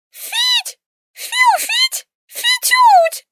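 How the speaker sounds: high shrill whistle sounds; angry whistling creature. Acted. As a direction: whistle indignantly like a small furry beast. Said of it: "разразился гневным свистом".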